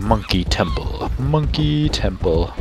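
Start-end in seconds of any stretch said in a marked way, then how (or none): 1.2-2.5 slow, high, drawn-out name; theatrical announcement. Pretend. Gamer grandly naming the temple he found, theatrical drawl. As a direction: stretch it out like a grand title.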